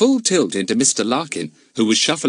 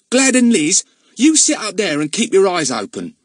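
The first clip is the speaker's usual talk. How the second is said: put-on high, louder young lad giving orders; cheeky lad telling the twins to keep watch; Ern, bossy boy; 11 semitones higher, 5 dB louder than usual. A narrator's reading. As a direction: boss the little sisters about.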